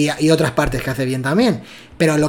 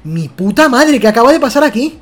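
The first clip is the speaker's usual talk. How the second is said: shocked exclamation; 6 semitones higher, 4 dB louder than usual. Retold or imitated. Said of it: "es decir".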